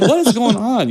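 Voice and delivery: high-pitched baffled mock exclamation; incredulous mock outburst. Movie reviewer acting out his confusion at the film, incredulous. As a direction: throw up a bewildered viewer's question.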